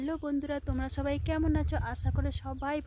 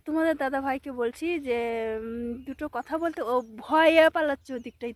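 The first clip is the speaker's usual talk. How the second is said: ordinary talk, nothing marked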